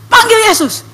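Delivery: high urging call; urgent remembered prompt. Retold or imitated. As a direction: cry it out as a sudden command, acted. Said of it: "ada orang berdoa".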